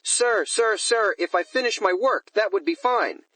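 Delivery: high, anxious pleading; afraid. A narrator's reading. Manager begs the superintendent to let him finish work, afraid. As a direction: plead upward, mildly panicked. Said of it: "They are both very afraid".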